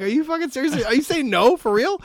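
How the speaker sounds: high incredulous laughing question; half-laughing outrage; squeaky amused protest; laughing disbelief. Retold or imitated. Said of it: "kinda laughing".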